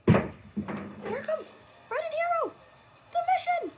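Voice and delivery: loud, high victory call; wrestling commentator. Acted. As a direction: announce the winners to the arena. Commentator calls the win.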